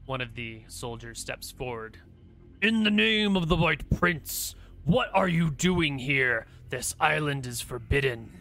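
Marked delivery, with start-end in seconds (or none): 2.6-8.3 loud, stern guard challenging strangers; commanding soldier. Acted. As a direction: bark it out like a sentry.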